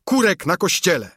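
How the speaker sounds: loud high cry; loud high exclamation; shout. Acted. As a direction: keep cheering. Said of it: "krzyknął chrzciciel".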